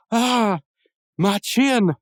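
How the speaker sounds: high, loud pained cry; mock pained scream. GBF kicked by Mordecai, hammed-up pained cry. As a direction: yelp as if kicked hard. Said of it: "he lets out this scream".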